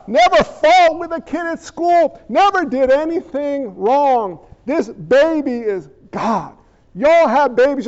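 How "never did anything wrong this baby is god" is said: ordinary delivery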